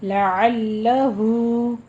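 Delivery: लअल्लहु with the final हु held two counts; tajweed demo. Shown as a demonstration. Teacher shows the short madd, slow. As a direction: hold हु of लअल्लहु two beats. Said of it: "हा के ऊपर मद्दे सिला सुगरा करनी है".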